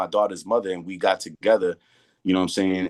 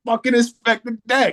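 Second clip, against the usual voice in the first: high, loud hyped announcement; excited reveal; 4 dB louder than usual. Retold or imitated. Revealing to his girlfriend who she just met, high excited exclaim. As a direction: land the name like a punchline. Punchline of retold story.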